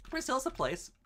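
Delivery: very high and sheepish; squeaky evasive voice. Acted. Streamer repeats the Brazil dodge, very high squeaky voice.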